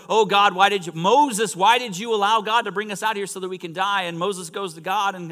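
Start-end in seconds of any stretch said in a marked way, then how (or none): 0.1-4.0 loud, high, rushed protest; escalated complaining. Acted. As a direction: wail at Moses in acted outrage. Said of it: "they're complaining even more".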